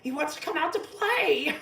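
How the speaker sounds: high, loud sing-song clown taunt; shrill mocking clown voice; taunting Pennywise. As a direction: tease her like a gleeful killer clown. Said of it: "Pennywise toys with her, being like".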